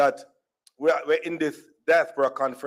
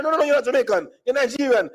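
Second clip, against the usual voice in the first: high, insistent denial; emphatic contradiction; 8 semitones higher, faster than usual. Retold or imitated. Stranger disputing his nationality, emphatic contradiction. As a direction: contradict flatly on a raised pitch.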